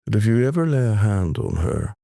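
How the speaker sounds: slow, measured warning with long pauses; unhurried, weighty imitation of a protective father; menacing voice in the ear. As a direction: deliver a deliberate threat, phrase by phrase. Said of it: "this voice came in my ear".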